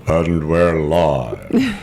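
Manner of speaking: hammed-up broadcast opener; mock announcer. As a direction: stretch it out like a radio host going live. Announcing the start of recording.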